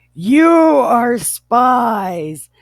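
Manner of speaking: slow, loud, high proclamation; shouted accusation. Acted. Joe accusing his brothers of spying, shouted. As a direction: draw the accusation out in a hammed-up shout.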